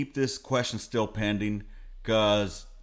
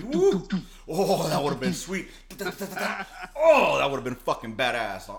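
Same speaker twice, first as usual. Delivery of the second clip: happy whoop; 4 semitones higher, slower than usual. Retold or imitated. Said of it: "I'm, like, happy".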